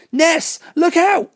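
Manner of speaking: high, slow, drawn-out scream of warning; yelled warning. Acted. Jane warning Vanessa of the falling ceiling, slow high yell. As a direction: stretch out a desperate yell. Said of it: "yelled out".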